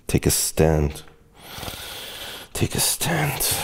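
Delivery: ordinary talk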